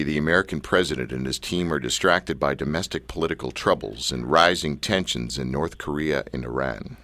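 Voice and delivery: low, deep announcer read; radio voice. Shown as a demonstration. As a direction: read it like a movie trailer voiceover. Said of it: "use my best radio voice".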